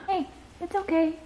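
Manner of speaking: high, hushed comfort; calm her down softly; soft soothing. Real, not acted. Mother hushing her crying toddler, high and soft.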